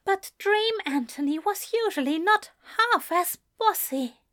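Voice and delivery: high-pitched character voice; Ezra, teasing. Acted. Ezra to Anthony, comparing him with dream, teasing.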